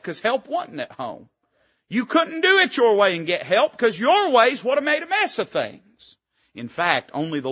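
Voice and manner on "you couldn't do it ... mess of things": much higher, louder preaching burst; full-force preaching. Acted. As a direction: hammer it home to the congregation.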